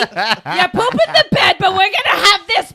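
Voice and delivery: loud, high, brassy coaching; hammed-up tough doula pushing through the birth; straight-talking doula. Doula to a woman in labor, loud straight-talking coaching. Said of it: "a straight talking doula".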